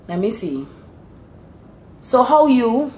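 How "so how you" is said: Bajan how you, rising higher on how; Bajan greeting. Shown as a demonstration. Explaining Bajan dialect to a listener, Bajan accent. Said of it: "which is to say".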